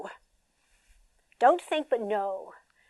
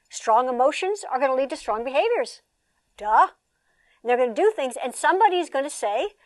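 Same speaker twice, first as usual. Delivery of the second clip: ordinary delivery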